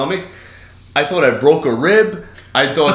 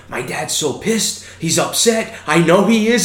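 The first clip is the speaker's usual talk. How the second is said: imitated freak-out; 7 semitones higher than usual. Daughter freaking out about her upset dad, imitated higher-pitched panic. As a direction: mimic a kid getting more worked up, rising as it goes. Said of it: "like, freaking out".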